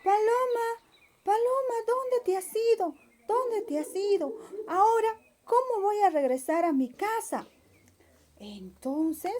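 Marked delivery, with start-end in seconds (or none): 0.0-7.5 high, anxious bunny calling out; a rabbit who lost his guide, calling. Acted. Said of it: "y dijo". (conejito llamando angustiado, voz aguda)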